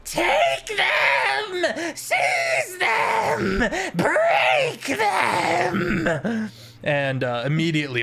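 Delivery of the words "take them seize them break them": high shrieking commands; roaring tyrant king. Acted. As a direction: scream orders at the guards. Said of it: "the Rat King goes".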